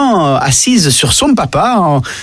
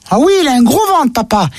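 put-on innocent child telling what he saw; voix d'enfant; 5 semitones higher than usual. Enfant répond. (voix d'enfant naïve, plus lente)

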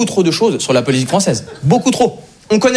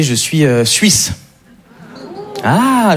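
ordinary delivery

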